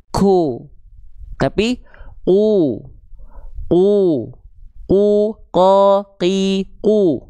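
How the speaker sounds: qaf dibaca "ku" dengan percikan nafas; wrong breathy qaf. Shown as a demonstration. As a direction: lafalkan "ko, ki, ku" dengan hembusan nafas keluar. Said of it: "nggak boleh dibaca gini". (contoh bacaan qaf yang salah, bernafas)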